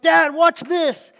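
put-on loud, high, drawn-out kid's call; excited kid shouting. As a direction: holler for Dad like a show-off child. Another child calling to father.

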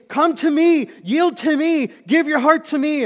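loud, high pleading call; God calling out. Acted. God calling the preacher's heart, loud and high.

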